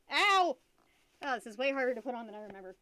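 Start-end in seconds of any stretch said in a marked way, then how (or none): 0.1-0.6 sharp, loud yelp of real pain; sudden ow. Real, not acted. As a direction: yelp as the head pinches. Hurts putting on possum head.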